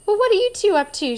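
high, loud and bouncy; bouncy springy boingy voice. A narrator's reading. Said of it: "she said in her bouncy voice".

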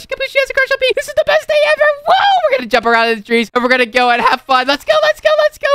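high, giddy celebration; squealing with joy; overjoyed. Acted. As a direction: burst with excitement. Celebrates that she likes him.

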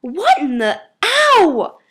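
puzzled start breaking into high yelp; screamed in pain. A narrator's reading. Girl yanking her sock off, high pained scream. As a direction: break off into a loud pained cry. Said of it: "she screamed louder".

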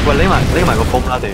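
fast, loud urgent yell; urgent shout. Real, not acted. Frantic callout mid-round.

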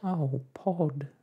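trembling. A narrator's reading. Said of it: "began again to tremble".

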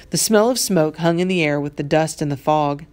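plain reading voice

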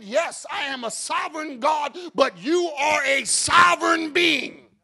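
loud, slowing shout on sovereign being; God's shout. Retold or imitated. Preacher voicing God to the congregation, booming shout. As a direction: boom it out like thunder from heaven.

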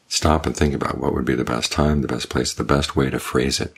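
ordinary talk

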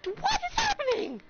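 very high, stunned cry; high-pitched, overwhelmed shock; shocked squeal. Real, not acted. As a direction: squeal it in disbelief. Shocked by flood of gifts.

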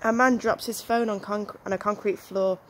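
normal talking voice

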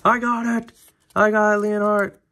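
loud, high triumphant yell; excited shout. Real, not acted. Opening packs on camera, yelling in triumph. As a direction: shout it out, thrilled to finally pull it. Pulls the card he wanted.

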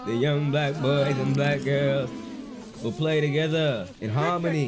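mock civil-rights orator. Player preaches harmony to the room, measured mock oration. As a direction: roll the phrases out solemnly like an orator. Continues famous speech as orator.

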